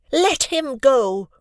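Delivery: put-on girl shouting 'Let him go!' at the bully across the room. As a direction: bark 'Let him go!' over the noise. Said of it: "Raising her voice over the cafeteria's noise".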